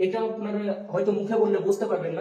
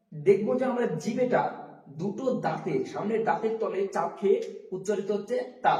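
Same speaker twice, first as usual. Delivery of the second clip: ordinary talk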